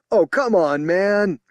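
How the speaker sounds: high, loud protesting shout. Acted. Officer heckling the spokesman, loud shout. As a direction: heckle the speaker. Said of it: "someone shouted".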